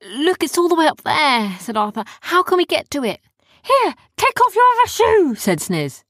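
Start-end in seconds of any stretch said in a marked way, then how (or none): 0.0-3.2 put-on higher, louder boy voice, urgent; anxious kid spotting the crystal; higher eager boyish voice; Arthur's voice. Arthur's character voice. 3.6-5.4 very high, loud squeaky creature; eager little helper with an idea; squeaky high sidekick voice; Sniz's voice. Acted. Sniz's character voice.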